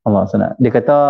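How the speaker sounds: normal talking voice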